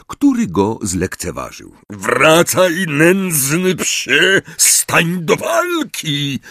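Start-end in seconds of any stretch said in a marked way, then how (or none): 1.9-6.4 high, slow bellowing knight; muffled knight's roar. Acted. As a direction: roar challenges from under a visor. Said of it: "ryknął Sir Cadogan ... przytłumionym głosem".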